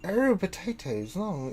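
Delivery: high, slow start, fading away; mumbling inventor lost in his own thoughts; distracted father. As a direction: trail off softly as the absent-minded father. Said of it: "you hear her father go".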